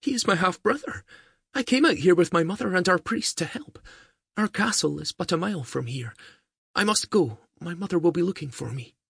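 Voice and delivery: put-on high, light girlish voice; a young healer girl speaking up brightly; young girl's voice. A narrator's reading. Young girl tells Diarmaid of her family, high girlish voice. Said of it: "She nodded eagerly".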